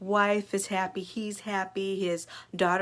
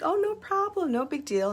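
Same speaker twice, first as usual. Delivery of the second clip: high, breezy no problem; a hint of a cheerful dorm supervisor; reassuring supervisor; 9 semitones higher than usual. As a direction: wave it off lightly for the worried dad.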